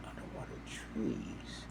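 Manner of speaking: barely voiced, whispered thought; hushed inner aside. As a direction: whisper it to yourself in disbelief. Inner thought on hearing danger.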